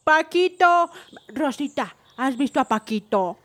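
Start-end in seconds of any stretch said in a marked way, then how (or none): 0.0-0.9 loud call, drawn out. Acted. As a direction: call across the pyramid. Grandma calling for lost boy.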